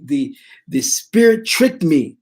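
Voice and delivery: normal talking voice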